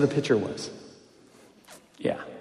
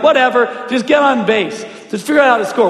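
raised, higher, dismissive retort; retold exasperated comeback; 7 semitones higher, 4 dB louder than usual. Pitcher to bickering teammates, exasperated comeback. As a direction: brush them off with mild mock annoyance.

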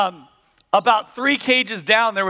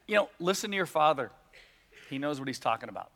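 much softer, reflective repeat of the advice; quiet reflection; 5 semitones lower, 10 dB quieter, faster than usual. Real, not acted. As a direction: let the lesson sink in quietly. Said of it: "thanks for coming to my therapy session".

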